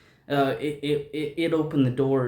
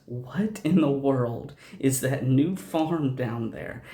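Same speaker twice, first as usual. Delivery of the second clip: ordinary delivery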